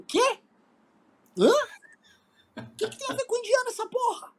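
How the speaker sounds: high, incredulous what?; baffled outburst, voice way up; disbelief. Retold or imitated. Retold own disbelief.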